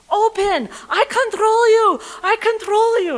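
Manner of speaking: high, loud repeated shouted command; furious yelling. Retold or imitated. Cop yells through the van window at driver. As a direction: bellow it, losing all control. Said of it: "on the other side of the window yelling".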